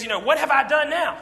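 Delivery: David snapping at his brother, mildly indignant; angry protest. Retold or imitated. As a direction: snap back at the big brother. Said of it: "David gets angry and says".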